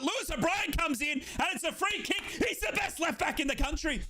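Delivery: excited shout. Real, not acted. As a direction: hype the player up. Praising player excitedly.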